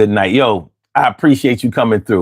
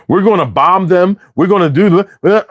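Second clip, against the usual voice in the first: blustering war talk impression, pitched up; mocking Hegseth; 6 semitones higher than usual. Comic to a livestream audience, a mock blustering war hawk. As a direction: ham up a tough-guy war speech. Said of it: "You ever hear this motherfucker talk?".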